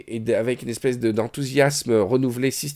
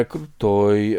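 put-on drawled Moscow accent; 4 semitones lower than usual. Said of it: "un accent qui traîne".

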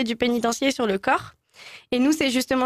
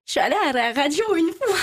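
put-on a raised-pitch Belgian accent with rolled R; rural Belgian accent; 5 semitones higher than usual. Guest doing a rural Belgian accent in the radio quiz. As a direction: roll the R like a country Belgian. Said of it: "depuis quand les Belges roulent les R".

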